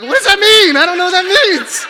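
loud, high-pitched outburst; exasperated yell. Retold or imitated. Climber dad protesting that he doesn't understand, exasperated yell.

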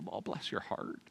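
put-on very soft, low Southern old-lady sympathy; hushed Southern condolence, like an older woman; deep Southern accent. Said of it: "say it with a deep Southern accent".

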